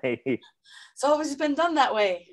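high, slow put-on silly voice; mock clueless voice. Student plays the monkeys for the teacher, high and slow mock voice. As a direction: drawl it out like a know-nothing monkey.

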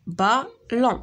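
بالون in two detached beats; syllable by syllable. Shown as a demonstration. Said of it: "دو سيلاب". (بالون مقطعة على جوج سيلاب)

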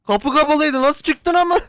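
high startled yell; scared shout. Real, not acted. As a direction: cry out in fright. Scared by sudden character. (korkmuş yüksek bağırış)

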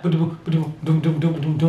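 low hummed beat sounds; beat imitation. Explaining old beats, humming the rhythm. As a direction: hum the old beat pattern. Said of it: "and be like".

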